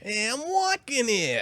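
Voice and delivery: put-on slow, drawn-out New York accent; mock New York accent. Friend mocking the New York accent back, slow and drawn-out New York accent. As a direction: stretch it out like a New Yorker yelling at a cab. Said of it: "Apparently, if you say that".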